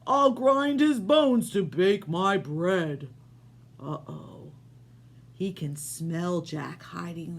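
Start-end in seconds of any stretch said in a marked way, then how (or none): 0.1-3.1 slow, booming giant voice; loud slow giant threat. Acted. Giant voice in read story.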